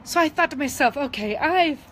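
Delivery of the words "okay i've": fighting back tears mid-sentence; welling up while retelling her own thoughts; choked up. Real, not acted. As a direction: voice tight with real emotion. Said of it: "I'm sorry, I'm getting emotional".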